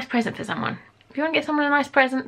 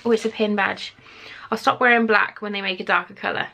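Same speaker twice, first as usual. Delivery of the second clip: normal talking voice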